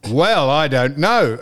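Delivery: slow, drawn-out clerk imitation; Byron. Phone clerk answers an irritated caller, slow mock cluelessness. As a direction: drag it out like a clueless phone operator. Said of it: "Wait for this".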